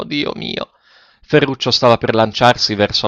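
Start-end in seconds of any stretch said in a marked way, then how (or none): 0.0-0.7 breathless, near-voiceless Dio mio; a faint whispered prayer at the end of her plea; despairing sigh. A narrator's reading. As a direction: let it fall away on a breath.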